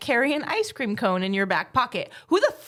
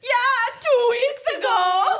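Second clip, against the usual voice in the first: whiny cartoon voice; 12 semitones higher, 4 dB louder, slower than usual. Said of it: "VeggieTales quote".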